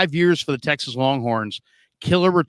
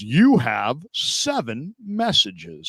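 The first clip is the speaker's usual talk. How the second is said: slow, word-by-word machine announcement; automated voicemail voice; much slower than usual. Retold or imitated. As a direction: imitate the voicemail robot reading a count. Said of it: "and it goes".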